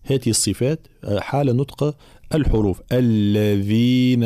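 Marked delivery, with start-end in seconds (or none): 2.9-4.2 very slow model الذين with a buzzing "dh"; correct voiced dhal. Shown as a demonstration. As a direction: chant الذين long, ذ voiced and flowing. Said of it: "هكذا".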